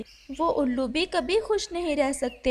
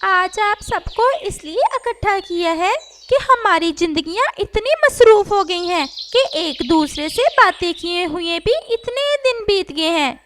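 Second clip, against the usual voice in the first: higher voiced parrot making an announcement; rally the birds together; a parrot speaking to a crowd of birds; parrot addressing the gathering; 7 semitones higher, 5 dB louder than usual.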